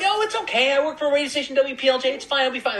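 very high, quick, squeaky self-parody; little squeaky voice. Acted. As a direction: squeak it out like a harmless pleader. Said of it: "me with my little squeaky voice".

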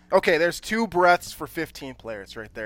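normal talking voice

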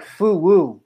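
"Foo woo" with drawn oo sounds; extra ooh. Retold or imitated. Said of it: "gave a little extra ooh".

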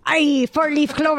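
playful put-on Irish accent. Host hamming up four-leaf clover luck, Irish accent. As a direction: lilt it like a cartoon Irishman. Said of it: "that was my Irish accent".

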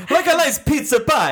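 loud, high, hammed-up Italian accent. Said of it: "the hands are just going when you're doing this".

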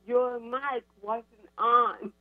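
each word slow and spaced; patient slow over-articulation; slow deliberate spelling-out. Retold or imitated. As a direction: spell it out slowly so she can lip-read. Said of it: "read my lips".

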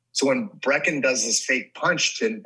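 ordinary delivery

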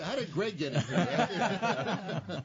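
high, loud, laughing through the words; laughing hard. Real, not acted. Host teasing the group about how Greg got in, laughing loudly. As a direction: crack up while telling it, stretched out.

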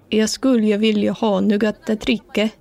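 Jag skulle vilja ha något att dricka in careful learner Swedish. Shown as a demonstration. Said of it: "the only thing I can remember how to say".

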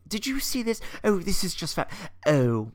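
high, bright Julie Andrews gush; Julie Andrews impression. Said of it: "did her Julie Andrews thing".

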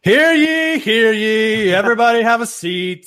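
high, loud proclamation calling the court; town crier. Pretend. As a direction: cry it out like a herald. Opening mock courtroom show.

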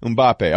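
Mbappe repeated as "um-BAH-pay"; corrected pronunciation. Shown as a demonstration. Host repeating the correction back, Mbappe as "um-BAH-pay". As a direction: practise Mbappe with open "bah" and final "pay". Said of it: "I'll never make that mistake again".

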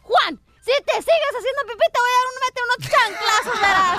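high-pitched scolding mom; angry mother. Acted. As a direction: threaten the kid, acting out an irate mother. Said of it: "voltea bien enojada y le dice". (mamá enojada regañando, voz aguda)

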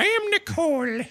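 high, put-on voice mimicking the intern; squeaky parody of the intern's line; mocking the accent. Host mimics the intern's odd accent, high and put-on. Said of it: "You did a little accent there".

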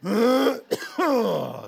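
a hard spluttering coughing fit; choking cough. Acted. Flattered drunk choking on his own spit, coughing fit. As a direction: choke mid-word and hack it out. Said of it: "choked on my own spit".

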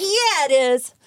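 pumped answer. Retold or imitated. Answering her hype song in the car, pumped up. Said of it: "And I'm like".

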